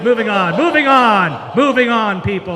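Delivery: calling out loudly over the crowd; loud moving on. Real, not acted. Comic to audience after a bad joke, loudly insistent. Hurrying past a joke that bombed.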